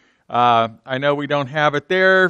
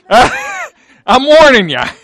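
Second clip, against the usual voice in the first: laughing warning; 7 semitones higher, 6 dB louder, much slower than usual. Real, not acted. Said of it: "So be aware".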